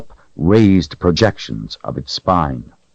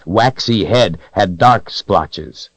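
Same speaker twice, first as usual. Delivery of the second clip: ordinary delivery, nothing marked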